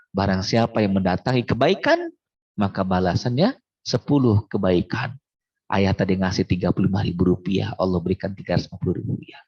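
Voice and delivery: usual speaking voice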